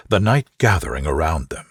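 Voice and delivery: plain reading voice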